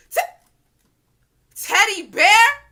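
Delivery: slow, high, very loud disbelieving yell; shocked shout. Retold or imitated. Hearing her own boyfriend's nickname, screaming in shock. Realizes it's her guy.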